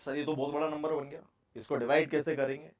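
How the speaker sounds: low, soft student aside; student's objection. Acted. Teacher voices a worried student's objection. (छात्र की धीमी, दबी शंका)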